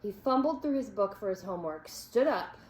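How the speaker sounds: ordinary delivery, nothing marked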